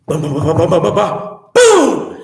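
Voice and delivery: bumping ba-ba-ba then a high loud boom; noise imitation. Storyteller imitating the noise upstairs, rising to a loud boom. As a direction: mimic footsteps then a crash.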